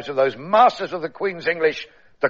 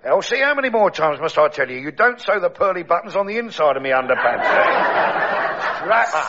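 lightly voiced Cockney pearly king, grumbling; a hint of a Cockney king moaning about his alarm clock. Pearly king grumbling to his wife Else, Cockney. Bert complaining to Elsie.

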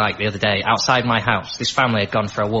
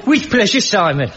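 high, loud Birmingham accent on request; 9 semitones higher, 4 dB louder than usual. Shown as a demonstration. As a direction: ham up a broad Birmingham accent, pitched high. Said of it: "give us your Birmingham accent again".

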